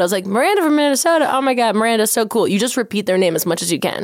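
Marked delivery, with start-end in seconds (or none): 0.2-2.5 high, eager and overjoyed; gushing excitement. Retold or imitated. Repeating a new friend's name, gushing and high. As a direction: gush over her name like she's your idol.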